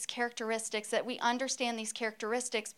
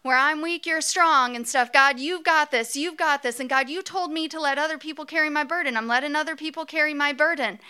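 relieved Moses, higher and louder; Moses impersonation; 3 semitones higher, 5 dB louder than usual. Pretend. Moses with arms held up, higher, grateful. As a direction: Moses thanking God with friends' support. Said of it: "And Moses is like".

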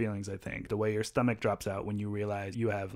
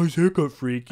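louder, higher dumb-voice parrot; mocking echo; 4 semitones higher, 7 dB louder than usual. Little kid echoing a bigger kid's taunt, mocking dumb voice. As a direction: mimic the taunt right back, mockingly. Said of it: "it is a mocking".

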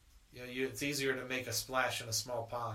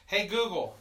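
raised voice to a smart speaker; loud command; 5 semitones higher, 5 dB louder than usual. Real, not acted. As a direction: call out clearly to the device. Repeating the failed voice command.

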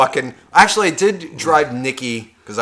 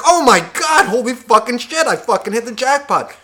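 much higher, thrilled inner cry; giddy excitement; 8 semitones higher than usual. Retold or imitated. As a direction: squeal like you just won big. Thinks he hit the jackpot.

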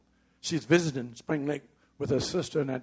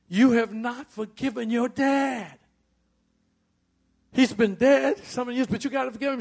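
firm urging; 8 semitones higher, 6 dB louder than usual. Retold or imitated. Husband urging wife to forgive.

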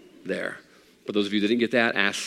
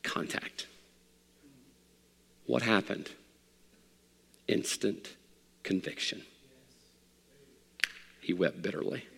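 hushed; 2 semitones lower, 9 dB quieter than usual. Acted. Pastor hushing the room at the story's climax. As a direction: let it fall quiet, almost breathless.

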